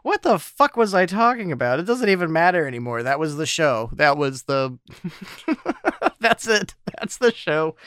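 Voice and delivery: high, loud, laughing through words; laughing while talking. Real, not acted. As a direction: talk through giggles.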